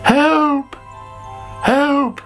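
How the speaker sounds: high-pitched acted cry for help; calling for help. A narrator's reading. Sammy suddenly feels strange, calling for help in high alarm. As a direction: call out twice in storybook alarm. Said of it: "called Sammy".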